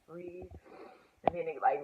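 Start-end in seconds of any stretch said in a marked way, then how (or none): 0.1-0.5 whispered, drawn-out prompt; imitated calm coaching. Mom coaching her through the waves, soothing whisper. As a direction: hush it like a birth coach.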